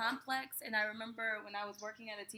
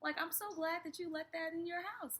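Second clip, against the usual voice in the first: excited; 7 semitones higher than usual. Retold or imitated. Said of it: "and I was like".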